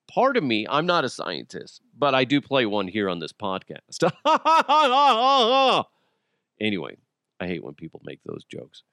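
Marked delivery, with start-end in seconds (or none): 4.1-5.8 high, loud fake guffaw; long forced laugh at a corny joke; hammed-up laugh. Host laughing at own stale podcast joke, hammed-up.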